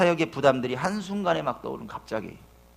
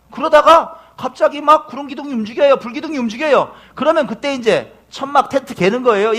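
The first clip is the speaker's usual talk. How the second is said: high, loud pulpit storytelling; full-force preaching; 6 semitones higher, 9 dB louder than usual. Acted. 광야의 기둥 이야기를 회중에게 외치며, 힘찬 설교. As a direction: paint the wilderness vividly.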